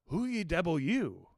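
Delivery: slow, loud savage challenge; Queequeg's broken English. A narrator's reading. Queequeg in the dark challenges the narrator, slow and menacing. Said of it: "he said at last".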